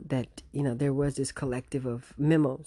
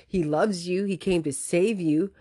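ordinary talk, nothing marked

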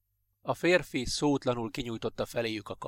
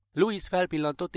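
ordinary delivery, nothing marked

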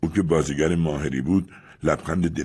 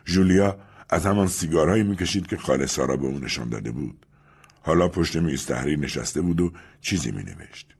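usual speaking voice

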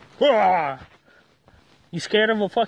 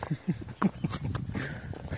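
soft breathy chuckle; 6 semitones lower, 9 dB quieter than usual. Real, not acted. Laughing at the angry dog.

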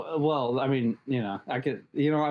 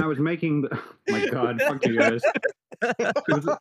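laughing; 4 semitones higher than usual. Real, not acted. Caught out, laughing at friends.

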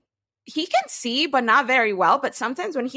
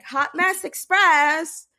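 playful self-announcement; 7 semitones higher, 4 dB louder, much slower than usual. Retold or imitated. Announces herself entering school.

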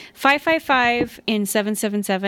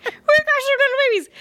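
very high, squeaky leprechaun; 4 dB louder than usual. Pretend. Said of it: "You were like a leprechaun".

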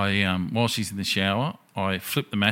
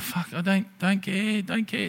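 higher, fed-up brush-off; annoyed boyfriend at two a.m., higher; exasperated dismissal; 10 semitones higher, slower than usual. Retold or imitated. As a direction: wave her off, done with it. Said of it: "I'm like".

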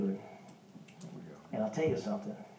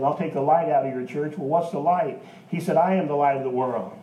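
loud, lifted pulpit voice; preaching at full force; 2 semitones higher, 5 dB louder than usual. Real, not acted. Preacher declaring God's words to the congregation, loud. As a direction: proclaim it to the church.

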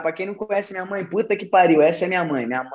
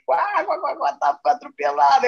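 mock cackling laugh; 6 semitones higher than usual.

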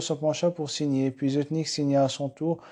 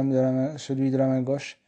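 plain reading voice

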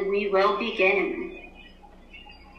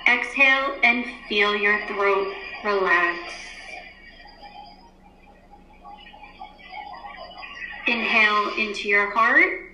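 guided meditation. Acted. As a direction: ease the class into stillness. Teacher leads class meditation.

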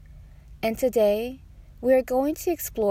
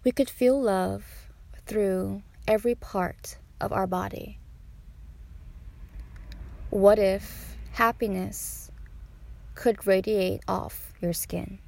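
guided meditation. Acted. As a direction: invite listeners gently into meditation.

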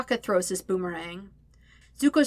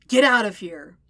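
loud, irritated order; forceful dismissal; snapped; 8 dB louder than usual. A narrator's reading. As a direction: snap it to drive someone away. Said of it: "Zuko snaps at the idiot".

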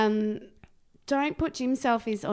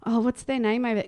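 ordinary talk, nothing marked

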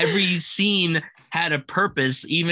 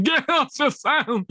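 much higher, slow and dragging; slurred drugged voice; 12 semitones higher, slower than usual. Retold or imitated. Quoting the film with friends, slurred drugged voice. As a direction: mash the words like a paralysed mouth. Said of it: "I could not stop going".

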